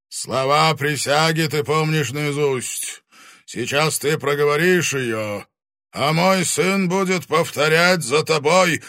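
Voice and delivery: menacing fire dragon voice; booming arrogant dragon. Acted. Dragon orders.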